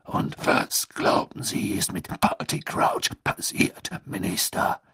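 rough, voiceless growl; gruff, rasping challenge. A narrator's reading. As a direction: growl the question at the minister. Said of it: "ertönte Moodys knurrende Stimme".

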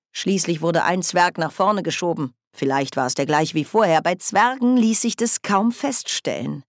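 ordinary delivery

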